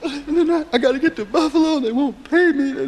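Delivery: high, tearful woman's voice; mock a crying singer begging for her pay. Woman backstage wails she can't get paid, mock tearful. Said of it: "And she was crying backstage".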